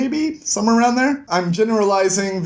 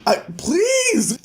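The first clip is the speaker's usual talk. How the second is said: eager Tenpei; 13 semitones higher than usual. Retold or imitated. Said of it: "where he's like".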